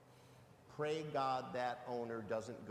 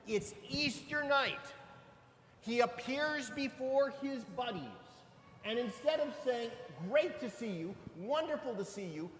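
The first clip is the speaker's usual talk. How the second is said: high, loud pulpit preaching; preaching at full force; 7 semitones higher, 6 dB louder than usual. Acted. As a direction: lift the congregation, proclaim it. Climax of homily preached loudly.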